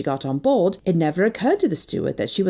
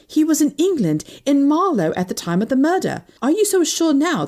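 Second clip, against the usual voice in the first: excited urging; 8 semitones higher, 3 dB louder than usual. Acted. Excited revelation to Suzanne.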